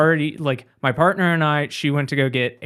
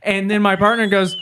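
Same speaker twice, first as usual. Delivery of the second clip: normal talking voice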